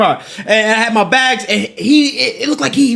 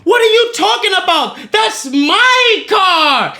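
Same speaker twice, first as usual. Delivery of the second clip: much higher, slow outraged protest; indignant outraged voice; 9 semitones higher, slower than usual. Retold or imitated. The man protesting it's his own car, high outraged squeal. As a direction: squeal it like he's the wronged one. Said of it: "He's like".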